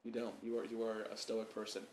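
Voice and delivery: ordinary talk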